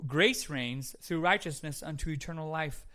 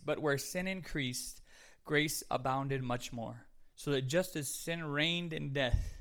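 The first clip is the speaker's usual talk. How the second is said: slow, solemn Bible reading; scripture reading; 2 semitones lower, 4 dB quieter, slower than usual. Acted. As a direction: read the verse slowly and reverently. Reads scripture.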